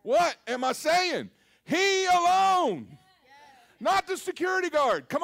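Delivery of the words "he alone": He alone, higher, louder and slow, alone stretched; emphatic declaration. Shown as a demonstration. Pastor proclaiming to the congregation, slow and emphatic. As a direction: proclaim it slowly like it's settled. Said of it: "say it like it's so".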